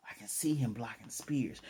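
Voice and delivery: whispered inner thought; a doubtful whisper in his head. Retold or imitated. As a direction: whisper it to yourself, half believing. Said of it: "in his mind, he's like".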